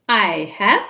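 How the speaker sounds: bright, loud, carefree answer; gaily. Acted. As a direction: say it cheerfully, off guard. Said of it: "He answered gaily in his own voice".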